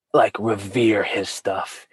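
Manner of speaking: ordinary talk, nothing marked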